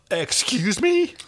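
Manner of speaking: gasp, then high, slow question; shocked. Acted. John learns he was priced, shocked. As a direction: gasp and stretch it out in disbelief. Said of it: "his shock expressed in a dropped jaw".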